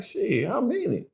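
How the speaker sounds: high, startled "how many?"; shocked. Retold or imitated. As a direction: blurt it out in disbelief. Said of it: "So I'm like".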